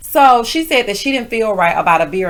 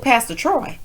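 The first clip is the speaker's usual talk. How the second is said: normal talking voice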